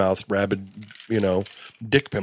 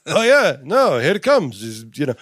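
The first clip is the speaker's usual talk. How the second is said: Yiddish-inflected voice, raised pitch; 8 semitones higher than usual. Retold or imitated.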